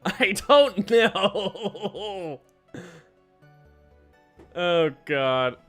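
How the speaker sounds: drawn-out words dissolving into laughter; laughing helplessly. Real, not acted. Reader gives up on the failed accent, laughing helplessly. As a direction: draw it right out and crack up.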